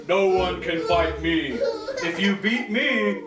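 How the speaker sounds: slow, deliberate giant's challenge, lightly voiced; boasting giant. Goliath taunting the Hebrew army, lightly voiced slow boast. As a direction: menace them with slow words like a bully giant.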